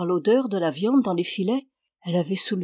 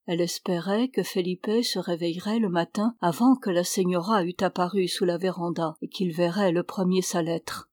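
ordinary delivery

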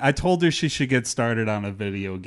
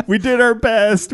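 laughing; 11 semitones higher, 6 dB louder, slower than usual. Real, not acted. Joking about chair heights.

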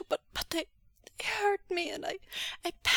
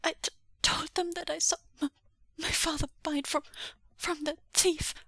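high, halting, tearful voice; distressed, broken phrases; sobbing confession; 8 semitones higher than usual. Acted. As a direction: stammer out a guilty confession. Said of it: "Xuanyu sobbed".

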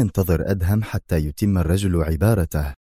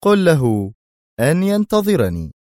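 higher, menacing message; 10 semitones higher, 3 dB louder than usual. A narrator's reading. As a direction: make the threat chilling. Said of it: "في لهجة مخيفة".

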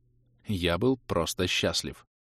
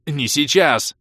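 loud, raised, curt cut-off; barked; 6 semitones higher, 7 dB louder, slower than usual. A narrator's reading. Mage cuts off the elf's story, barking. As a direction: bark it to shut him up. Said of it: "рявкнул я".

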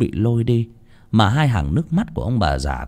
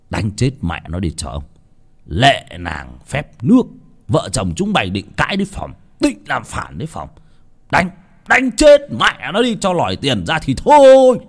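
cruel master's order; 2 semitones higher than usual. Acted. Master Chánh orders his men to beat the couple, building to a roar.

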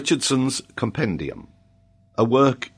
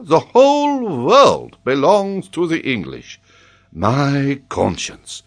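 slow, emphatic, theatrical scholar; amused professor; 5 semitones higher, 5 dB louder, slower than usual. Acted. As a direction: marvel grandly with drawn-out words. Paganel mocks the geography teaching.

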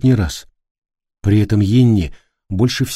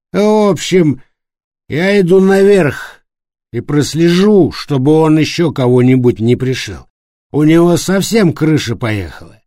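put-on drunk determined; 6 semitones higher, 4 dB louder than usual. A narrator's reading. Пьяный Флориан идёт наверх.

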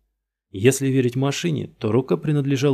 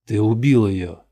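accusing; 6 dB louder than usual. Acted. Detective accuses suspect.

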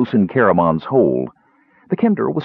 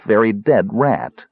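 plain reading voice

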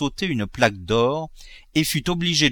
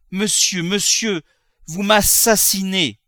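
high, drawn-out protest; anguished cry; 9 semitones higher, 5 dB louder, slower than usual. Acted. Sick mother to her husband, anguished cry. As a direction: cry out as if being killed.

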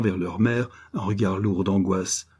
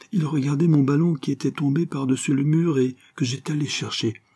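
put-on higher-pitched child telling a story; earnest little boy; 6 semitones higher than usual. Boy tells his mother about grandfather, earnest and childlike. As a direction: recount it eagerly as a small boy.

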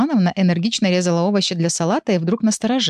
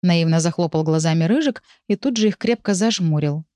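ordinary delivery

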